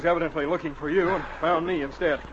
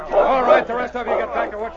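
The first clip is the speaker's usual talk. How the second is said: shouting; 7 semitones higher, 6 dB louder than usual. Acted. Captain orders crowd back.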